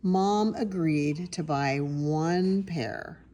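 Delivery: ordinary delivery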